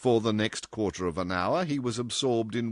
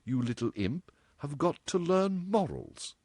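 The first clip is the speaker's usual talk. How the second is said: a touch higher, drawn out at the end; lightly voiced character scolding; 7 semitones higher, slower than usual. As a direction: deliver a mild, measured telling-off.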